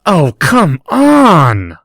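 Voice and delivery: loud, drawn-out frustrated cry; fierce disappointment. Acted. Draco to Harry, fiercely disappointed and loud. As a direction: let the letdown burst out. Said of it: "with fierce disappointment".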